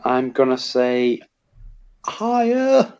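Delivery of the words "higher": "higher" said much higher than usual; higher-pitched voice. Real, not acted. Contestant gives his answer "higher" in a much higher voice. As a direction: let "higher" float up high in pitch. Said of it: "Are you saying higher in a higher accent".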